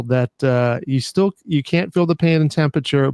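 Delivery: normal talking voice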